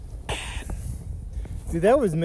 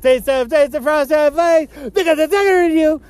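higher, hammed-up ad pitch; mock yelling; 6 semitones higher, 4 dB louder than usual. Hyping made-up cereal to friends, mock yelling. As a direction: yell it like an over-excited commercial. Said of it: "Why are you guys yelling?".

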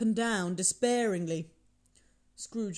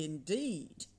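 ordinary delivery

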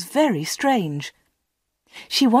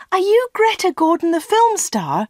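high-pitched, starstruck question; amazed; 11 semitones higher, 4 dB louder than usual. A narrator's reading. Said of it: "Anna was amazed".

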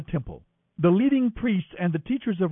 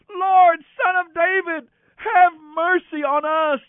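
even higher, louder desperate cry; shouting louder; 13 semitones higher, 7 dB louder than usual. A narrator's reading. Blind men shout over the crowd, louder and higher. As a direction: cry out harder, slowing on the last plea. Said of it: "they only shouted louder".

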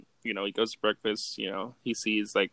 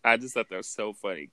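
usual speaking voice

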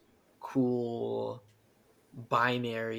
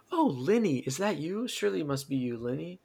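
higher, lilting fond greeting; delighted recognition; 4 semitones higher than usual. Acted. Lady Zora recognizing Link by nickname, delighted greeting. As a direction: greet a long-lost friend with mock delight.

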